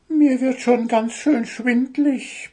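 very high-pitched bird voice; complaining little bird. A narrator's reading. Little bird tells the boat crew he's getting dizzy, complaining. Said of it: "beschwerte sich Zwitschi".

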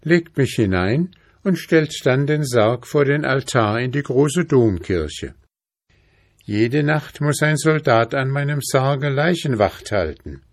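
put-on high, eerie, commanding girl's voice; commanding princess voice. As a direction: order it high and ominous.

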